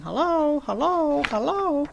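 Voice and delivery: the hidden parrot calls again. A narrator's reading. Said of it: "the voice came again".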